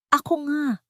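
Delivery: shout. Acted. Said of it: "sigaw niya".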